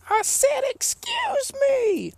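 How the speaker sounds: very high, slow, drawn-out "Excuse me!"; shriek-stretch each "Excuse me" way up high like the booed heel; hammed-up high mock heel screech, slow and insistent; Vicky Guerrero's "Excuse me!". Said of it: "you know, like".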